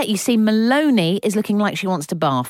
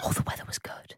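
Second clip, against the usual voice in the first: whispered, no voice; whispering; 11 dB quieter, faster than usual. Pretend. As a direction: breathe it as a whisper. Mock whispered line.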